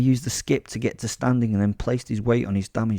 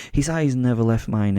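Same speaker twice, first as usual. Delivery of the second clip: usual speaking voice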